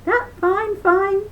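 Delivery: high cheery rabbit; high gleeful rabbit; gaily. A narrator's reading. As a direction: chirp it happily. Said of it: "smiled Brer Rabbit gaily".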